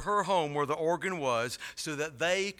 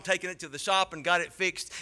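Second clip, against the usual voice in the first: normal talking voice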